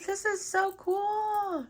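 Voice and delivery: high, slow delighted gush; light up at the find. Real, not acted. Seeing the new beads, a high slow delighted gush.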